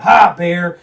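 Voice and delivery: loud call into the dark woods; yelling at a bear. Retold or imitated. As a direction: shout it out to warn the bear. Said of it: "I'm yelling".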